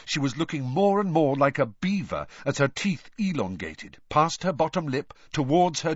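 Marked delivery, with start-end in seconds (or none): none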